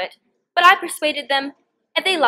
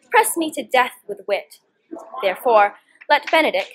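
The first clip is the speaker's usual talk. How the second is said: ordinary delivery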